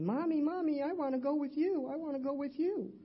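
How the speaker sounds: put-on high-pitched small child's plea; imitating her crying little boy. Little son begging his mommy to take him along, high childlike plea. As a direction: beg like a tearful little boy. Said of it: "the little face crying to me".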